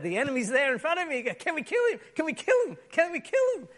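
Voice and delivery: high, fast, eager asking; a king giddy to kill his enemies, high and quick; all excited. Acted. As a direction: bounce with eager glee at the chance. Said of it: "he was all excited".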